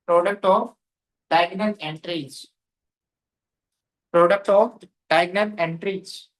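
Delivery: very slow, drawn-out words for note-taking; slow dictation. Real, not acted. Teacher dictating a matrix rule to students, slow dictation. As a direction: dictate phrase by phrase with long pauses.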